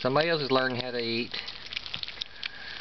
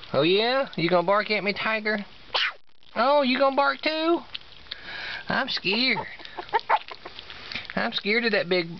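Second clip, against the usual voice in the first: much higher sing-song voice for the puppies; high pet-talk voice; 6 semitones higher than usual. Real, not acted.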